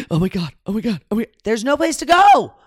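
mock panic rising high and loud; hammed-up panic, building as if cornered; panicked. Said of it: "I'm panicking".

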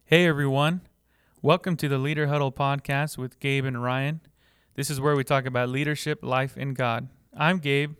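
loud, punchy show opener; big projected host welcome; podcast intro. Acted. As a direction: announce the show with energy.

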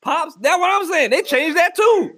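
high put-on indignant voice; mock outrage. Ranting about Pops cereal, high outraged voice. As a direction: squeal it like an outraged kid.

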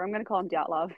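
normal talking voice

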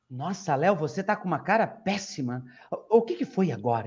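normal talking voice

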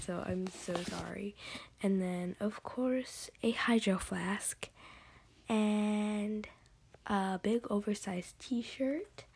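normal talking voice

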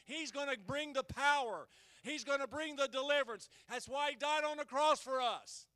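loud fiery preaching; preaching at full force. Acted. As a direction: preach it out to the congregation.

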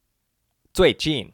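"最近" said very slowly, both syllables falling sharply; slow fourth tones. Shown as a demonstration. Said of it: "Z U I in the fourth tone and J I N in the fourth tone".

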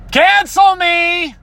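very high, slow, hammed-up cry; mock defiance. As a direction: throw it out like a theatrical dare. Mock defiance after forgetting a name.

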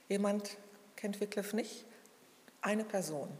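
ordinary talk

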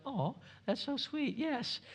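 high, soft imitation of his wife; wife's voice. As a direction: coo it sweetly like his wife. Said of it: "And she was like".

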